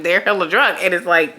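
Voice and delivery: normal talking voice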